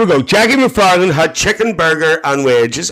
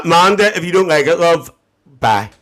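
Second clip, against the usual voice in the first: ordinary delivery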